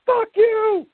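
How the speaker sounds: high, squeaky mock curse; mock angry retort. Tony's high squeaky curse at Donnie, hammed-up. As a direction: spit it back in a high cartoon voice. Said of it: "He's like".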